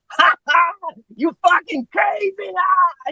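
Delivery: high, screamed mock drunk holler; high-pitched yell with forced ha-has; drunken yelling. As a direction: imitate a trashed guy screaming across the bar. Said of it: "yelling and screaming".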